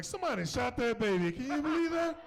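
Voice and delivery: loud, high mock disbelief; shocked outcry. X-ray tech finding bullets under the baby, loud disbelief.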